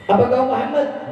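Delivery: high eager question; hope he has found him; high, hopeful asking; hopeful traveller. Acted.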